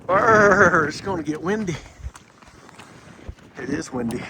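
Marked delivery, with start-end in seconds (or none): 0.1-0.9 shivering brr. Real, not acted. Cold wind on the summit.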